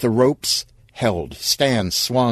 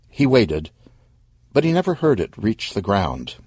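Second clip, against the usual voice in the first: ordinary delivery, nothing marked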